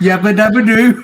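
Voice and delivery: Fred Flintstone call. Pretend. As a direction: bellow it like the cartoon caveman. Quoting the Flintstones catchphrase.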